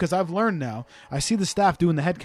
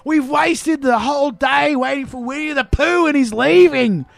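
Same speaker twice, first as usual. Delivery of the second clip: put-on whiny Australian kid; 8 semitones higher, 3 dB louder, slower than usual. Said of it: "this kid was just like".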